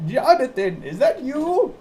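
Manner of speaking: put-on high, calling old lady voice. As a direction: call out like a frail old woman.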